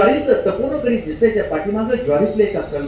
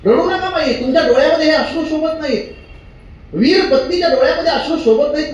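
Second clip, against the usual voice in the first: Savarkar consoling wife; 8 semitones higher, 4 dB louder than usual. Retold or imitated. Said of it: "Savarkar आपल्या पत्नीला म्हणतात".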